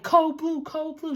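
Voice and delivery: high, loud shout of code blue; retold scream. Doctor shouting the alarm into the hallway, high loud yell. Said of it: "She starts screaming in the hallway".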